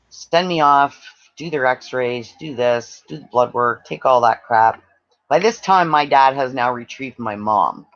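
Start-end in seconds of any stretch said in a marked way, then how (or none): none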